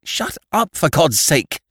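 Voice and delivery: high, loud, anguished snap; fierce, tortured cry. A narrator's reading. Annie silencing the others, fierce and tortured. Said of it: "cried Annie fiercely, as if in torture".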